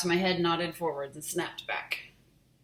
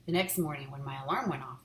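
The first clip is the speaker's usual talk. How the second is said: plain reading voice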